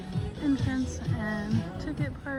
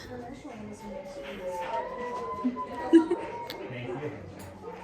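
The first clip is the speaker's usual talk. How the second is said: whispered laugh; 3 dB quieter than usual. Real, not acted. Laugh after whispered aside.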